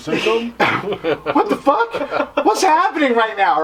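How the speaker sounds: high, laughing what-the-hell; mock bewildered crowd, laughing; mock confusion laughing. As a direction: laugh through baffled onlookers' words. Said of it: "everyone's looking at me like".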